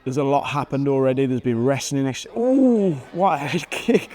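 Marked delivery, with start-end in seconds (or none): 2.4-4.1 sudden high gasp of surprise at the replay; ooh, what a hit; surprised ooh. Real, not acted. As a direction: wince and marvel at the kick. Reacting to head kick replay.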